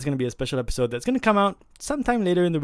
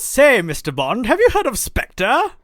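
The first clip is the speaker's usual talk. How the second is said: high, loud, stagey spy-film voice; put-on spy-skit voice; 10 semitones higher, 7 dB louder, slower than usual. Host quizzing Mister Bond in a spy skit, high put-on voice. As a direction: declaim it loudly in a high, hammy voice. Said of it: "why I'm talking like this".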